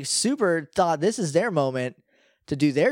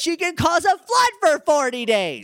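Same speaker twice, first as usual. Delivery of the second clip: high dramatic voice; 10 semitones higher, 4 dB louder than usual. Acted. Mock threat about Lugia.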